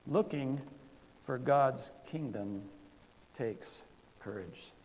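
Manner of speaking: slow, spaced out for note-takers; slow dictation. Shown as a demonstration. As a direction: dictate each word for the blanks. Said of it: "here's more answers to the blanks".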